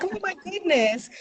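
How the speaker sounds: high, soft mock gasp of surprise; surprised gasp. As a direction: retell her startled, hushed reaction in a high voice. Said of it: "And she's like".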